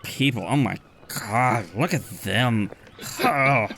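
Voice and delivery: lightly voiced mocking merfolk, slow and sneering; sneering Triton. Triton at the bar sneers at the newcomers, mild mock voice. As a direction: sneer at the land people, slowly. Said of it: "And one of them says".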